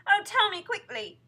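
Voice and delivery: high, loud, urgent begging; pleading cry. A narrator's reading. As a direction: implore him desperately. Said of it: "she cried".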